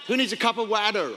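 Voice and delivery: put-on American twang on water; imitated American pronunciation; American accent. Shown as a demonstration. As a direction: ask it American-style, water as "wadder". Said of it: "if you're American, it's water".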